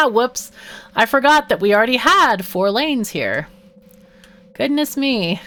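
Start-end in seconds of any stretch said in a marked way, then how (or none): none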